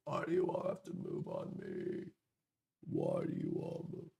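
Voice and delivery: croaky vocal fry, low and rough. Real, not acted. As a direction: creak through it with a parched, fried voice. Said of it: "my voice is like permanent vocal fry".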